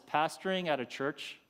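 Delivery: ordinary talk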